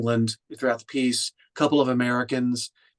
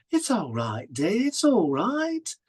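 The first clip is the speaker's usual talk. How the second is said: drawn-out quote, leaping much higher; comic film character; 7 semitones higher, much slower than usual. Retold or imitated. Quoting the end of the funny scene, comic character voice. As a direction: play the comic line slow and swooping.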